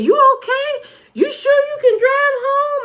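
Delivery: high, worried mock friend voice; high voiced friend. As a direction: squeak out the concern like an anxious friend. Voicing concerned friend.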